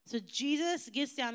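ordinary talk, nothing marked

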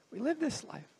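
softly spoken, letting it sink in; hushed repetition. Acted. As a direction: drop the volume for a reflective repeat. Repeats the line more softly.

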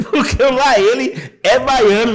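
falando no meio da gargalhada, agudo e alto; rindo. Real, not acted. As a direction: squeal it through a big laugh. Rindo da gíria baiana. (falando às gargalhadas)

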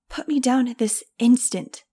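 shouted command. A narrator's reading. Director dangling midair orders her release, loud shout. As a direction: bark it out indignantly. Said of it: "she shouted".

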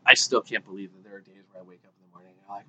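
ordinary talk

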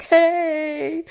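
high, loud called-out hello; big squealed greeting, acted out; imitated group greeting. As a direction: mimic friends cheering a surprise. Imitates waiting friends calling a greeting.

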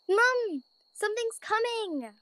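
put-on high, urgent child's plea; urgent little bunny. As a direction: tug at mom's sleeve with the words. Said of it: "Bella said urgently".

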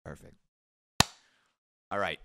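ordinary talk

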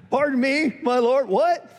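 pitched well up in disbelief; a stunned, incredulous who-me; baffled Gideon. Acted. Gideon's baffled reply to angel.

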